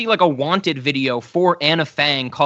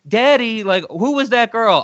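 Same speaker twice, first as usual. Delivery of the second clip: quoted daughter; 6 semitones higher, 3 dB louder than usual. Retold or imitated. Voicing Catherine asking her father.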